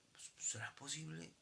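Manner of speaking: breathy doubting whisper, voiceless. Real, not acted. Preacher asks the congregation if it is possible, whispered. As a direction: whisper it as if sharing a secret.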